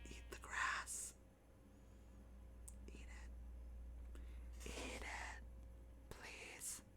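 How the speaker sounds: soft unvoiced pleading whisper. Real, not acted. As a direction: beg it under your breath. Whispered pleading at the game animal.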